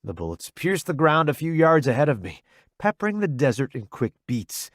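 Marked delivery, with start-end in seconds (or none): none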